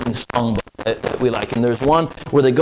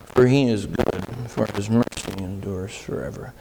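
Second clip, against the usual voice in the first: low, soft, lifeless mumble of the verse; a sleepy murmured recitation; mock mumble; 8 semitones lower, 6 dB quieter than usual. Shown as a demonstration. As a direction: deliver it like a bored, half-asleep congregation. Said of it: "Do you think that's how they said it?".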